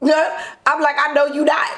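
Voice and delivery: repeats I know you not with a laugh, pitched high; laughing retelling. Retold or imitated. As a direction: laugh through the comeback. Repeating her reply, laughing.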